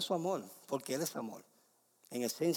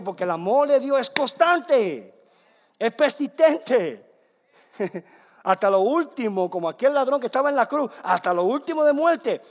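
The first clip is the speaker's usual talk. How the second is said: loud, raised preacher's voice; impassioned preaching; 5 semitones higher, 9 dB louder than usual. Real, not acted. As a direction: proclaim it forcefully to the congregation. Preaches God's persistent love.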